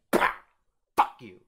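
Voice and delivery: sudden loud burst; explosive mock yell; imagined angry outburst. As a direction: lash out loudly in a mock attack. Imagined attack with the printer.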